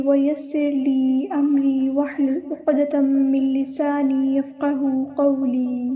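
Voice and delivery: slow, measured recited invocation; slow chanted Arabic invocation; formal opening recitation. Acted. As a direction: intone the opening prayers slowly.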